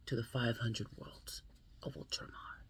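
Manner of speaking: hushed whisper to close the passage; whispered ending. Acted. Vlogger closing the book reading, hushed whisper. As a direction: breathe the last words.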